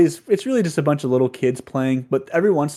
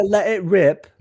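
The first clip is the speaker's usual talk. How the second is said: "Let it rip" in a hammed-up British accent, pitched up; mock British "Let it rip."; 6 semitones higher, slower than usual. Shown as a demonstration. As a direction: shout "Let it rip" like a cheeky Brit. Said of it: "Oh, say".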